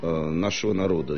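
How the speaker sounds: normal talking voice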